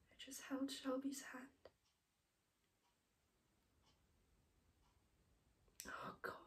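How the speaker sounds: very soft, halting, with long pauses; quietly bereaved, barely getting the words out; hushed grief. Acted. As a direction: voice sinking almost to nothing. Grieving account of a deathbed.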